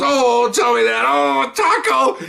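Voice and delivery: high, drawn-out put-on voice for the boss; amused boss. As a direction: stretch out taco as the delighted boss. Boss savoring the taco joke.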